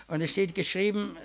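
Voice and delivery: ordinary delivery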